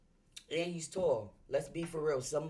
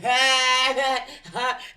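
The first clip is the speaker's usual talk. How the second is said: loud laugh; 9 semitones higher, 14 dB louder than usual. Real, not acted.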